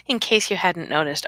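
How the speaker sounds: ordinary talk, nothing marked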